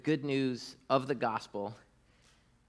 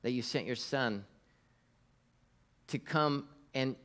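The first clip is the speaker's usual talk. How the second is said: softer, hushed prayer voice; 3 semitones lower, 3 dB quieter than usual. Acted. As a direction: pray quietly and sincerely. Opens a prayer.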